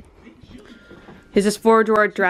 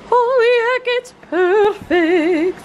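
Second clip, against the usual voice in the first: high, drawn-out gushing; 11 semitones higher, 3 dB louder, slower than usual. Real, not acted. Host admiring her own giant cake, high gushing. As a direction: gush in a high, slow voice.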